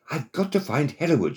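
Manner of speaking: high-pitched narrated cry; shouted plea. A narrator's reading. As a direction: call out desperately, pitched well up. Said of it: "she shouted".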